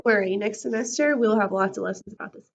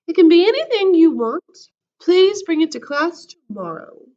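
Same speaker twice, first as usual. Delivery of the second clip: announcing; 6 semitones higher, 11 dB louder, slower than usual. Acted. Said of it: "she announced".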